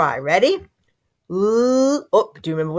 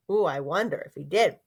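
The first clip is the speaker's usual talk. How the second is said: ordinary talk, nothing marked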